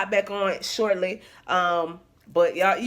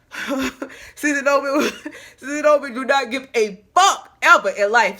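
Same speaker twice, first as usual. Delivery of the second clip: laughing; 5 semitones higher, 5 dB louder than usual. Real, not acted. Laughing hype about confidence.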